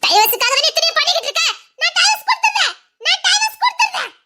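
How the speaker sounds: very high, strained cry of giving in; wife screaming that she will give the divorce; frantic high cry. Acted. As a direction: wail it out, close to breaking. Woman screams about divorce.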